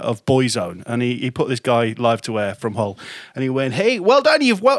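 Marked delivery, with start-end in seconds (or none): 3.7-4.8 higher, mock-cheery jock voice; imitating a DJ. Mock DJ hands a caller the prize. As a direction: ham up the cheery radio host. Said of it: "I can't do the Irish accent".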